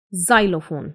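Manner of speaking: "xylophone" starting with a clear z sound. Shown as a demonstration. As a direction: open "xylophone" with z, not ks. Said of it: "ज आएगी". ("xylophone" ज की आवाज़ से)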